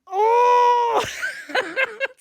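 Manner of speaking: high, loud shouting laughter. Real, not acted. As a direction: laugh hard at your own reaction. Laughing after reenacted gasp.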